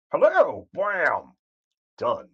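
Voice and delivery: high, loud, punchy mock burst; mock quick kill. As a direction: fire it off like a fight over in a second. Mimicking a fight ended instantly.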